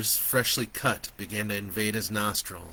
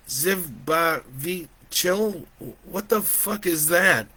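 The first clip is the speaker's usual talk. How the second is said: slow, higher, incredulous question; bewildered and louder; baffled; 8 semitones higher, 4 dB louder, slower than usual. A narrator's reading. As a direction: sound out the strange word in disbelief. Joe baffled by a strange word.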